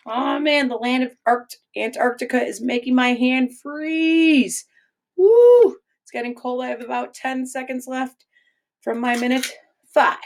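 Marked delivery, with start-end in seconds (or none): 5.2-5.8 sudden high loud woo; high yelp. Acted. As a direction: yelp from the cold. Hand on ice.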